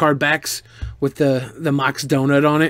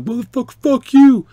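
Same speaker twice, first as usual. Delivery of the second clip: imitating drunk barking; 8 semitones higher, 4 dB louder than usual. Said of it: "barking at me".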